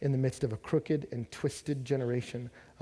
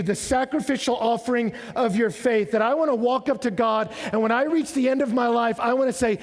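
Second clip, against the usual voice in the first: higher, louder preaching that drives every phrase; full-force preaching; 9 semitones higher, 8 dB louder than usual. Acted. Pastor preaching on Paul's sacrifice to the church, full force. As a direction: preach it to the back row.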